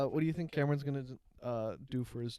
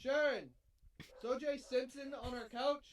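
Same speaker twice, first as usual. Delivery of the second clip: high yell into the next room; shouting across the house; 11 semitones higher, slower than usual. Pretend. Yelling to wife offstage.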